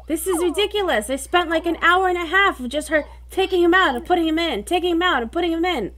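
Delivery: exasperated venting, pitched high; frustrated rant. Real, not acted. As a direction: rant in fed-up disbelief. Venting about repeated failed feeding.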